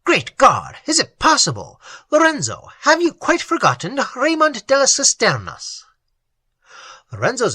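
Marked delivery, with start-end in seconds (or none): 0.0-5.8 higher, louder astonished recognition; astonished cry. A narrator's reading. Raymond recognising his old friend Lorenzo: astonished higher cry. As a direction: voice jumps up in amazement. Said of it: "he cried".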